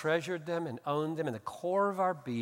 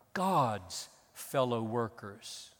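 "God's fellow workers" slowly, leaning hard on "God's"; slow weighted "God's" at the start; stress on God's; 3 semitones lower, much slower than usual. Shown as a demonstration. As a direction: linger on "God's" and let "fellow workers" fall. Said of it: "what the emphasis of this paragraph is".

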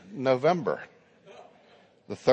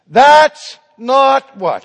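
very loud, drawn-out outcry, pitched high; cried-out protest; 9 semitones higher, 13 dB louder, much slower than usual. Retold or imitated. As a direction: cry out in slow, indignant protest. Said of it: "they cried out in protest".

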